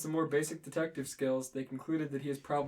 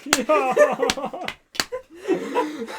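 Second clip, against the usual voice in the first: laughing; 8 semitones higher, 9 dB louder than usual. Real, not acted. Cracking up mid-narration.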